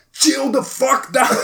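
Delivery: high, drawn-out mock shout; retold angry command. Storyteller replaying grabbing a man by the head, shouted command. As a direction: act out ordering him down, stretching the words.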